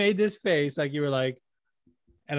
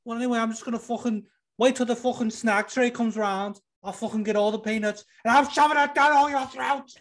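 high-pitched ranting fighter impression; Darren Till; 8 semitones higher than usual. Fighter threatening lads over snack-tray peanuts, high ranting impression. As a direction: pitch it way up and build to an outburst. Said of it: "That's Darren Till".

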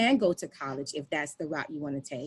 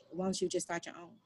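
soft, calm suggestion; calm husband; 10 dB quieter than usual. Retold or imitated. Husband calmly suggesting she start her own program. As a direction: ease the idea out gently. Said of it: "looked at me very calmly and was like".

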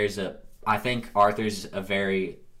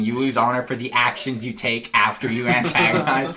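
normal talking voice

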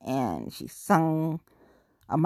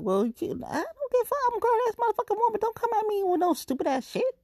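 much higher, fast sassy put-down; sassy retort; faster than usual. Retold or imitated. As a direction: fire it back high and fast, full attitude. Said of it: "people are like".

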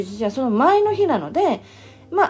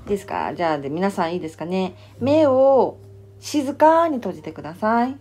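guided meditation; 5 semitones higher, 5 dB quieter than usual. Acted. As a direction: guide them into calm. Leads a meditation.

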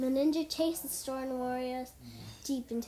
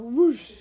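big burst of whooshing; storybook sound effect, punched out; loud whoosh; 13 dB louder than usual. A narrator's reading. Ninja race out of the dojo, loud whoosh.

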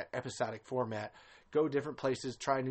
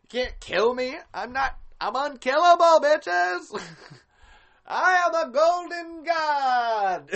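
much higher, much louder hammed-up boast; gloating Bert; 13 semitones higher, 11 dB louder, slower than usual. Bert boasting to everyone that he survived, hammed-up triumphant yell. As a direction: crow it out like an unkillable hero, laughing. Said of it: "he comes back out and he's like".

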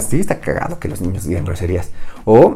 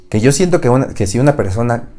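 ordinary delivery

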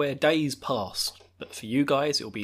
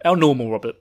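ordinary talk

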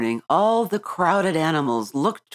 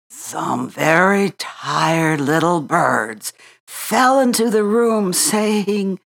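plain reading voice

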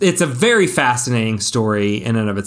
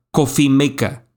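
put-on coffee maker in a quick Spanish accent; Spanish-accented English, R dropped from maker; 2 semitones higher than usual. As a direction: imitate a Costa Rican saying English words. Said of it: "in a quick Spanish accent".

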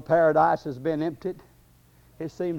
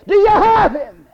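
very high, loud devil's shout; devil crying out; 12 dB louder than usual. Acted. Devil questioning the grave clothes about Jesus, crying out. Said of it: "the devil cries to the grave clothes".

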